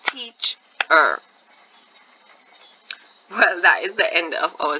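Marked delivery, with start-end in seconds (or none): 0.0-1.2 TEACHER firmly broken, TEACH ... ER; TEACHER with a long gap between TEACH and ER; clapped-out syllables. Shown as a demonstration. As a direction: clap out TEACHER as TEACH, then ER, louder. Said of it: "I can clap out two beats".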